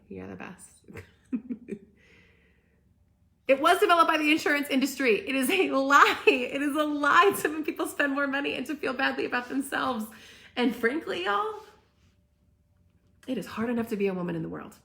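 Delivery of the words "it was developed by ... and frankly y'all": loud, higher, heated rant; fired-up protest voice. Real, not acted. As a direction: hammer it home with outrage. Ranting against BMI to viewers.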